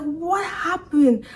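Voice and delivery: high, drawn-out wail; mock crying. Driver coming to after the crash, mock-tearful bewilderment. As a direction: imitate your own tearful bewilderment, stretched out.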